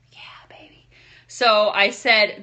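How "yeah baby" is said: soft whispered praise; whispering. Retold or imitated. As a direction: whisper it warmly. Quiet praise, unvoiced.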